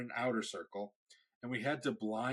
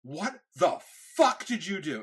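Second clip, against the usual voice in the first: higher, louder mock angry dad; imitating an angry father, raised voice; 6 semitones higher, 7 dB louder than usual. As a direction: bark it like a furious father. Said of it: "He was pissed".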